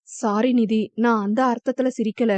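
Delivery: plain reading voice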